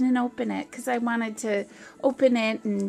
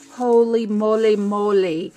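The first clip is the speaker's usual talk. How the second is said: normal talking voice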